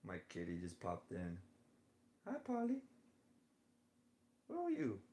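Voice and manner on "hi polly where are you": very high pet-talk voice, sing-song; cooing at the cat. Real, not acted. Speaker greets her cat Polly who just popped in, cooing high.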